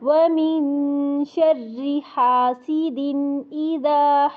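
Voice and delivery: slow melodic tajweed chant of कुल अऊज़ु बिरब्बिल फलक; Quran recitation. Shown as a demonstration.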